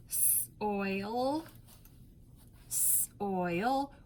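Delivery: "soil" drawn out with a rising end; sounding out "soil"; stretched soil. Shown as a demonstration. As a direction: stretch "soil" to hear oi in the middle. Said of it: "we think about the sounds".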